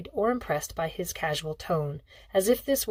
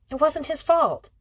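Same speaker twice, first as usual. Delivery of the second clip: Kat's voice; 9 semitones higher, 5 dB louder than usual. A narrator's reading. Kat defends Hale to father.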